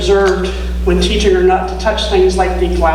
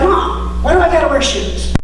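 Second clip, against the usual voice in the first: put-on high, whining little girl; whiny child; 7 semitones higher than usual. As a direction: complain like a small kid. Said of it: "she's like".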